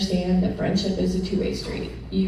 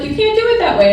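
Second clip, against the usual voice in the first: much higher, louder, laughing; laughing quote; 6 dB louder, faster than usual. Retold or imitated. Quoting the professor's verdict, laughing high. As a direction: quote him while laughing.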